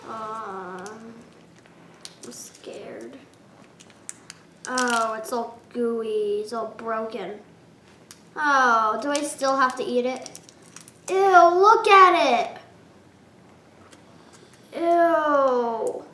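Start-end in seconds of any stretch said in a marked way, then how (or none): 0.1-3.2 scared whisper; hushed, nervous whisper. Real, not acted. Nervous before sour candy. 11.1-16.0 slow, disgusted ew. Real, not acted. Disgusted at gooey candy.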